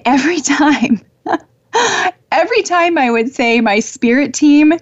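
giggling through the words, pitch up; real laughter breaking into the sentence; laughing. Real, not acted. Host telling listeners about her funny angels, laughing. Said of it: "I'm laughing so much".

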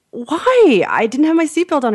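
high-pitched retold whine of why; mock protest. Driver questioning the policeman over a ticket, mock protest. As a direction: plead innocence, hammed up.

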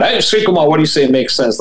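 a listener calling out to the teacher; mock listener question.

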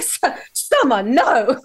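lightly mocking scolding voice, slowed; imitating him. Summer imitating his reaction, mock scolding. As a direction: mimic his gentle protest. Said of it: "He's like".

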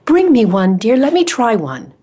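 a hint of a gentle grandma calling; sweet little grandma. Grandmother asks her granddaughter for a cookie, lightly voiced sweet call. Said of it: "in her sweet little voice".

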